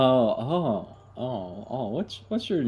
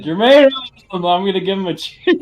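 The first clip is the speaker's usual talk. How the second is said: higher, louder toast; excited cheer; 7 semitones higher, 9 dB louder than usual. Real, not acted. Player raising a glass to the headless corpse, excited. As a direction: call out the toast with glee.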